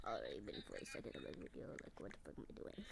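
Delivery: fast hushed whisper to himself; whispered self-mockery; whispered aside. Real, not acted. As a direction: mutter it under your breath, quickly.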